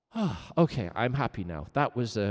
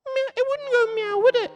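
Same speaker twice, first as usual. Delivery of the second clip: much higher, louder teasing question; squeaky teasing; 9 dB louder than usual. Shown as a demonstration. Teacher teasing chat in a high squeak. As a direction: tease the class in a high squeak.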